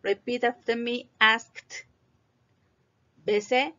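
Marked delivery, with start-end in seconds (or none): 1.2-1.9 "asked" as one syllable ending in a crisp t; final T. Shown as a demonstration. Teacher says repeat after me, audible final T. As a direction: say "asked" like "askt", e silent. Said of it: "Se tiene que oír la T".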